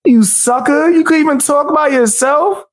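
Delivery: very high-pitched outburst; heated heckling. Real, not acted. Streamer telling the interrupter to leave, high heated jeer. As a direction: heckle them with the voice jumping way up.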